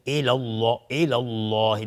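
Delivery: الله with a thick, dark L; heavy L. Shown as a demonstration. Teacher drawing attention to tongue position, heavy L in الله. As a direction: recite الله with the L full and dark. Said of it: "دقت کنید حالات یا حالت زبان در دهان".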